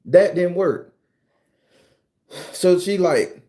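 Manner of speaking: usual speaking voice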